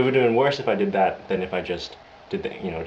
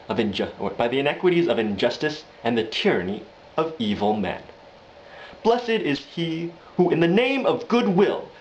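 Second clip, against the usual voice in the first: Samuel Jackson; 5 semitones higher than usual. Acted. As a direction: preach it, rising in pitch and force. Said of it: "Samuel Jackson, he's walking around".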